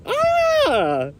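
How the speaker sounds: sudden high yelp of surprise; a quick shocked cry; a real jolt of surprise, sharply high; startled yelp. Real, not acted. Startled by wind on the beach.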